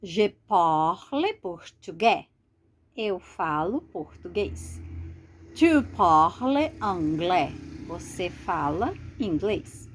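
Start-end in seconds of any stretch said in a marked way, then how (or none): 0.0-2.3 Je parle portugais read slowly and clearly for the class; slow, careful French example sentence; slow model sentence. Shown as a demonstration. 5.5-7.5 Je parle portugais read slowly and clearly for the class; slow, careful French example sentence; slow model sentence. Shown as a demonstration.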